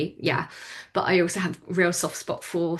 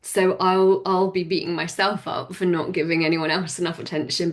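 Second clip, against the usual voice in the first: normal talking voice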